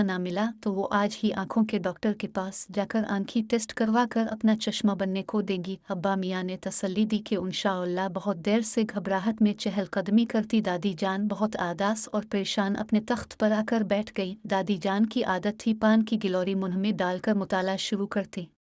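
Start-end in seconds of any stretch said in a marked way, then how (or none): none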